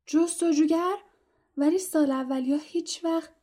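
astonished gasp of a question; surprised. Acted. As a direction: blurt it out in amazement, mouth still full. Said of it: "از تعجب آن را فراموش کرد".

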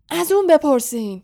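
high, loud accusing shriek. A narrator's reading. Caretaker pointing the blame at Harry, shrieking. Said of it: "جیغ زد".

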